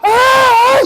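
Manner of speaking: high, loud mock wail; imitated sobbing scream; father's wail. Father hearing his son on the phone, high loud wail. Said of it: "Y llega el papá".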